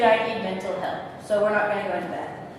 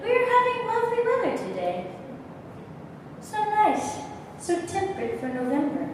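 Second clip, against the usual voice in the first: high-pitched, awkward small talk; awkward evasion; 10 semitones higher, 4 dB quieter than usual. Acted. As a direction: dodge the confession with awkward pleasantries, voice pitched up. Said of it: "staring at you awkwardly".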